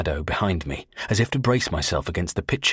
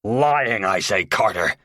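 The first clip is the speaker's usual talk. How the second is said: louder, forceful insistence; more strongly; 2 semitones higher, 4 dB louder, slower than usual. Acted. Tony recovering, insisting forcefully. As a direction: insist hard, pushing back. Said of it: "Then more strongly".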